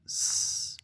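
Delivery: long sustained hiss for "S"; pure held sss on "S"; isolated S sound. Shown as a demonstration. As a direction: say "S" as a drawn-out sss, not the letter name. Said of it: "fera le son S".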